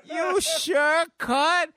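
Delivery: very high cartoon voice; high, drawn-out silly voice; Mister Poopy Butthole. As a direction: squeal it slowly like a cheery cartoon creature. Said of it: "Mister Poopy Butthole".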